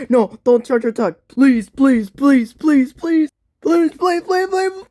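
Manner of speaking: desperate pleading building to a high shriek; frantic begging rising to shriek. Acted.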